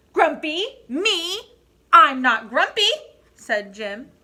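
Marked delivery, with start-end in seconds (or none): 0.1-2.9 higher, louder, offended protest; indignant denial. Acted. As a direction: scoff at the very idea, loud and high. Monkey denies being grumpy.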